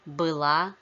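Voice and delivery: была stressed on the second syllable, быЛА; stress on ending. Shown as a demonstration. Teacher models feminine past of быть, stress on ending. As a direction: hit the final ЛА in была. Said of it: "stress is on the ending in feminine forms".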